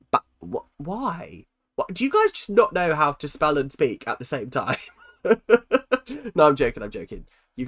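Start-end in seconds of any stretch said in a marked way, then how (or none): none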